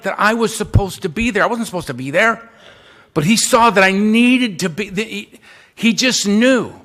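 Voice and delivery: usual speaking voice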